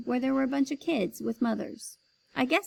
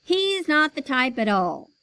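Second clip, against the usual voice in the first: loud dismissal; 4 semitones higher, 6 dB louder than usual. Acted. Boss dismissing child.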